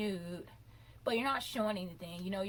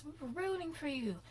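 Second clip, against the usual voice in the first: higher, rising into a mock yell; Tyra's famous rant; 6 semitones higher than usual. Recreating Tyra's famous scolding of the contestant. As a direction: imitate the scolding judge. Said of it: "Tyra did that famous".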